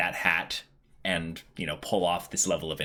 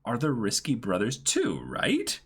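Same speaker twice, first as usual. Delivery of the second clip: reading fan letter; 5 semitones higher than usual. Acted. Reads fan letter aloud.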